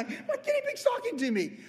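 very high mock-startled squeak; high comic disbelief; startled owner. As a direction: squeak it like a man stunned his pet talks. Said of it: "he's like".

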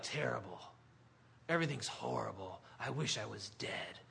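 low, soft voice of misery; gloomy. Acted. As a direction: sink into mock despair, dropping pitch and volume. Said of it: "just that gloom and that poison".

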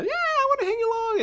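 put-on high, pestering kid voice; annoying little brother. Little brother pestering older brother and his friends, annoyingly eager. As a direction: pipe up eagerly like a tagalong sibling. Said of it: "I'm the annoying brother".

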